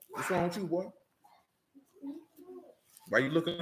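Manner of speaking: ordinary delivery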